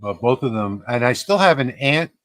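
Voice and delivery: ordinary talk, nothing marked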